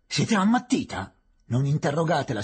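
loud, high exclamation; indignant outburst. Acted. Uproar after the witness withdraws, indignant and loud. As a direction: burst out in outraged protest. Superintendent and objector in courtroom uproar.

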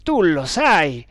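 loud, drawn-out accusation; accusing exclamation. A narrator's reading. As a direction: exclaim it slowly and forcefully. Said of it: "esclamò Tremal Naik".

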